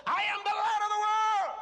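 high, loud, triumphant proclamation; bold declaration. Acted. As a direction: declare it with full confidence. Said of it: "How many times have you said".

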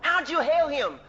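usual speaking voice

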